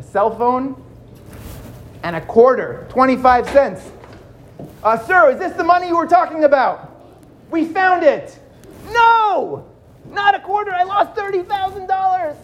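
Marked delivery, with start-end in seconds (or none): 4.8-8.4 higher mock-polite question; wry cop. Cop shows found quarter. 8.9-12.4 high, pleading protest; exasperated victim. Acted.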